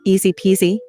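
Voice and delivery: ordinary delivery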